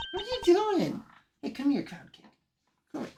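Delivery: very high cooing to a dog; pet-talk voice. Real, not acted. Streamer asking the dog what it's doing, high pet talk. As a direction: coo it up high.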